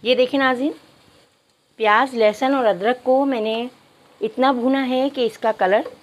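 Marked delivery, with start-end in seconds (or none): none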